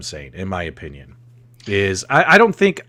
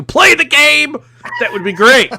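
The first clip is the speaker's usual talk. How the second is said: acted yell; 14 semitones higher, 9 dB louder than usual. Acts yelling on imagined call-in.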